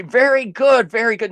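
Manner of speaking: high, patronizing sing-song; condescending praise. Acted. Mocking his opponent like a teacher, condescending.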